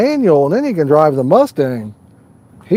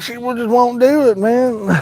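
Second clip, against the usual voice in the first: retold protest; 7 semitones higher than usual. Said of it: "And I'm like".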